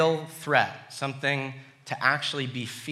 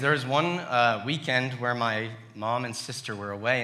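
normal talking voice

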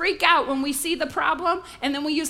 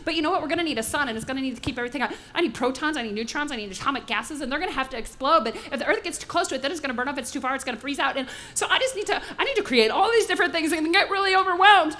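fretting planner; faster than usual. Retold or imitated. Preacher mocks an overwhelmed God planning creation, fast and fussy. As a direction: rattle off worries in a fast mumble. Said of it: "what that does is he didn't say".

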